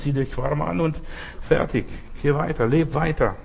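usual speaking voice

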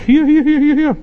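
high, beckoning call of hier; Jesus calling Thomas. Retold or imitated. Risen Jesus calls Thomas to touch wounds, high beckoning voice. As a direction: sing out each hier up high. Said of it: "Und sagt, „Thomas, komm mal her".

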